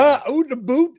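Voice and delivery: put-on Canadian accent, a cheerful jab. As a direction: ham up a Canadian oot in the boot. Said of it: "the Canadian accent right there".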